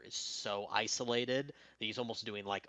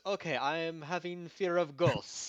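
put-on loud, high broken-English Eastern European accent; Viktor's Eastern European accent; 7 semitones higher, 9 dB louder than usual. Viktor in his Eastern European accent claims a fear of ghosts. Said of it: "And, and he goes".

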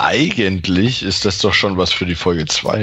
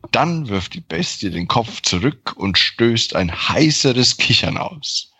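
ordinary delivery

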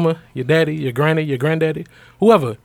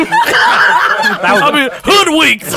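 laughing; much slower than usual. Acted. Mock outrage joke.

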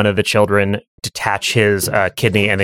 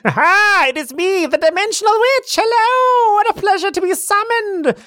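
high cackling witch greeting. Acted. GM as the Dimensional Witch, high and gleeful.

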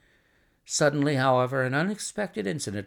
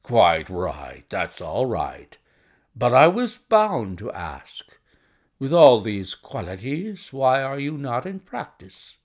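slow, emphatic businessman's voice; brusque visitor; 3 semitones lower, 5 dB louder, slower than usual. Acted. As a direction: press on loudly as a blunt questioner. Narrator voices the visitor Blessington.

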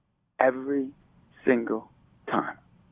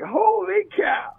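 1 mock amazed exclamation; much slower than usual. Exclaimed slowly, high.

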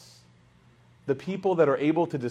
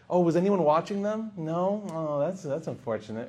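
passive aggressive; 3 semitones higher than usual. Acted. Guest probing the parents about supervision, passive aggressive. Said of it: "you start to go in this passive aggressive".

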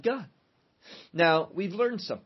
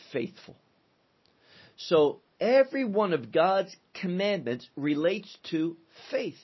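usual speaking voice

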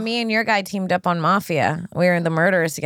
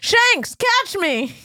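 calling out; 12 semitones higher, much slower than usual. Pretend. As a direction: call out mid-fall. Calling out on trapeze.

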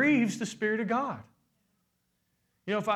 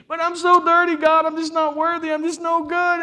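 much louder, higher despairing plea; whiny sinner; 9 semitones higher, 9 dB louder than usual. Pretend. Believer wailing to God, loud pleading whine. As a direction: wail your unworthiness. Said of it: "You're like".

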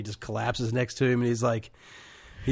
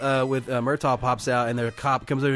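usual speaking voice

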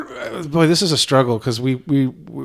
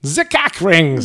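"The cake rings" drawn out slowly as "zee kek rings"; German accent; 3 semitones higher, much slower than usual. Shown as a demonstration. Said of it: "Is that better?".

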